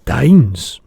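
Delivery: loud, triumphant giant; triumphant cry. Acted. Giant answering the girl, triumphant and loud. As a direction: crow it out in triumph. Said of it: "the giant cried triumphantly".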